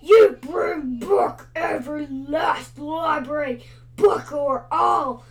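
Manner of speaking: loud shouted dragon roar, far from own voice. A narrator's reading. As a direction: roar it like a furious library dragon. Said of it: "roared Lot of Scales".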